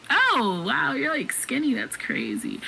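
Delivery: awkward surprise, retold; mild retold surprise. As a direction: act out a polite, taken-aback reaction. Surprised reaction to date's appearance.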